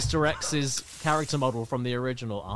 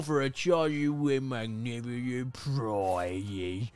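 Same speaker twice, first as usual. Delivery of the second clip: mock advertisement. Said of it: "mock arcade ad read".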